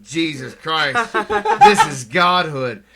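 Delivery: awed stoner. Retold or imitated. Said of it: "gripping the fucking pillows going".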